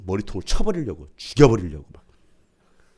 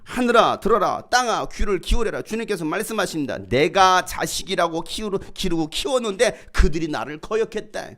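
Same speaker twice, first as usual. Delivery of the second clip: raised, declaiming voice of God's word; solemn scripture reading; 7 semitones higher, 4 dB louder than usual. Acted. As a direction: proclaim it from the pulpit. Reads God's words from Isaiah. (높여 선포하는 성경 낭독)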